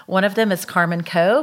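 usual speaking voice

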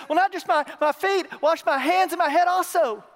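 acted eager outburst, high and loud; flipping out eagerly. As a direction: blurt it out loudly like an overeager disciple. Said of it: "He just flips".

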